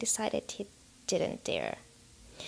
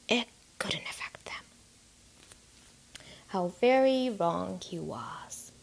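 ominous hush; 2 semitones lower than usual. A narrator's reading. Ominous narrator closing line.

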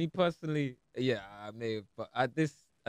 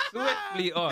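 laughing dodge; much slower than usual. Real, not acted. Dodging the dating question, laughing.

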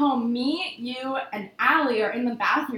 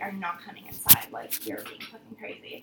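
low voice sinking toward a whisper; quiet refusal; 6 semitones lower, 13 dB quieter than usual. Retold or imitated. Dropping boyfriend at his house, quiet firm refusal. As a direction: refuse softly, fading away.

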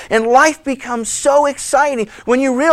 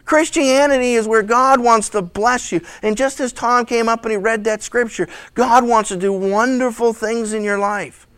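ordinary talk, nothing marked

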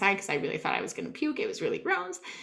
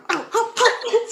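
stung yelps; 13 semitones higher, 4 dB louder, slower than usual. Retold or imitated. Getting stung by bees.

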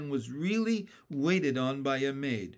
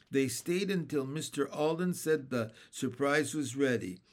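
plain reading voice

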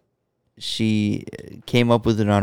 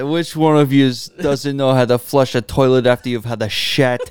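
harsh Irish mother, much higher to the end; Irish mom impression; 4 semitones higher than usual. Co-host quotes the Irish mom video, harsh Irish accent. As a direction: scold like a furious Irish mum. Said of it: "the video where the mom busts in".

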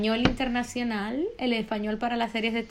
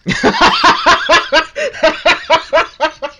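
loud laugh; 6 semitones higher, 14 dB louder than usual. Real, not acted. Laughing at mom's teasing. (carcajada fuerte)

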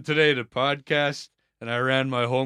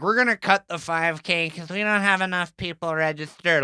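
put-on thick Minnesota accent, higher; broad Minnesota vowels; 5 semitones higher than usual. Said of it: "in the thick Minnesota accent".

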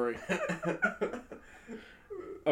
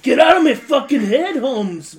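higher, louder, half-laughing yell of get out of my head; mock yell; 9 semitones higher, 11 dB louder than usual. Shouting at a friend's silly voice, mock angry. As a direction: yell playfully at the voice.

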